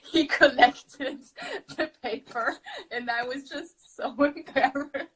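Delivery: words breaking up with laughter; laughing while talking. Real, not acted. Student recalling an embarrassing mishap in French class, laughing.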